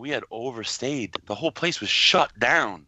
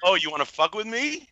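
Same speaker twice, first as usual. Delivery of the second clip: high-pitched mock confrontation; put-on challenge, pitched high; 12 semitones higher than usual. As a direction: imitate someone picking a fight, pitched way up. Voices angry Laura's challenge.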